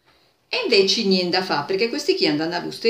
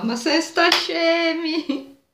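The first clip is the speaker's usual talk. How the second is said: mocking exclamation; 10 semitones higher, 3 dB louder, much slower than usual. Men mocking the frightened ones.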